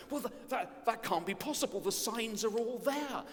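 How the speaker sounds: hammed-up disbelief, tripping over words and bursting out; overdramatic. Wise men protest to themselves, overdramatic. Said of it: "got a bit overdramatic".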